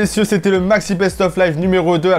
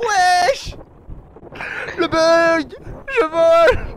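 yelling in glee at the bug; excited shouting; 13 semitones higher, much slower than usual. Real, not acted. Truck glitch sends him flying.

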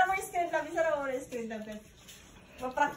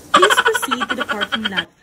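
laughing while talking; 9 dB louder than usual. Real, not acted. Laughs mid-line.

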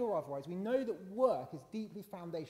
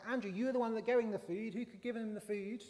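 high-pitched mock indignant retort; bickering; 7 semitones higher, 4 dB louder than usual. Disciple Peter answering Andrew's accusation, mock bickering. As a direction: throw the blame back, hammed-up and high. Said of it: "sort of bickering conversation".

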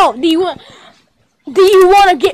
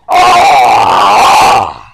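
long, hoarse play scream; agonized groan; 3 dB louder than usual. Pretend. Toy being pooped on, long hoarse groan. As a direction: stretch out a rasping mock-agony cry.

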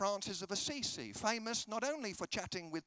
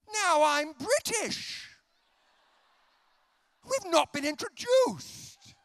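high, slow, loud protest; drawn-out indignant shout, hammed up; mock outrage; 10 semitones higher, 12 dB louder, slower than usual. British traveller protesting a stranger holding his hand, mock outrage.

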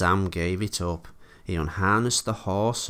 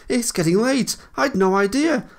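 high, loud, panicky excuses; flustered exclamation; 6 dB louder than usual. A narrator's reading. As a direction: make hasty excuses in a very high voice. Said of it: "exclaimed Thomas".

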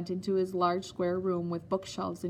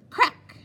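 a much higher, louder cane crack; sharp crack; 12 semitones higher, 11 dB louder than usual. Acted. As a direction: snap it out like a whip. Cane stroke sound imitated.

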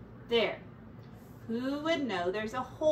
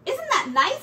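cheerful Ratty; 13 semitones higher, 9 dB louder than usual. Acted. Cheeky Ratty delights in the hole.

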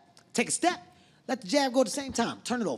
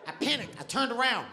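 ordinary delivery

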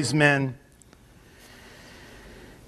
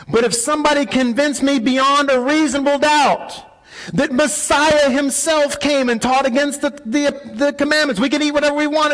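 fiery preaching; 10 semitones higher than usual. Acted. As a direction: preach it hard, voice lifted and pressing. Preaching at full force.

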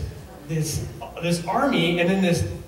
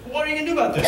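laughing high plea; 11 semitones higher, much faster than usual. Retold or imitated. David pleading with next brother.

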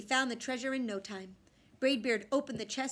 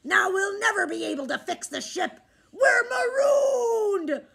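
much higher, loud anguished cry; despairing pirate; 10 semitones higher, 11 dB louder than usual. A narrator's reading. Pirate captain despairing over the eaten map. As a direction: wail at the lost map.

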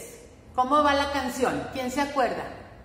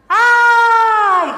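loud, high mock squeal of delight; playful squeal; 11 semitones higher, 16 dB louder than usual. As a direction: squeal as if splashed by cold drops. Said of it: "me hacen gritar".

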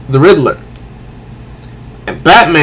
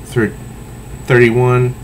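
ordinary delivery, nothing marked

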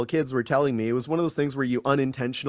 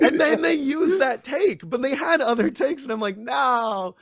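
high, drawn-out words through laughter; laughing in dismay; 12 semitones higher than usual. Real, not acted. Actor recalling his camera blooper, laughing in dismay. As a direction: tell it while cracking up, voice pitched high.

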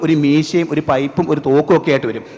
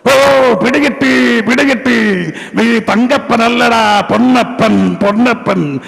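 higher comic actor voice with long-drawn calls; Janardhanan impression; 6 semitones higher, slower than usual. Comic character recognizing an old acquaintance, Janardhanan-style parody. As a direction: stretch the phrase endings in exaggerated recognition. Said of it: "നമ്മുടെ മനസ്സിൽ ഇപ്പോൾ കിടക്കുന്നൊരു ശബ്ദമുണ്ട്".

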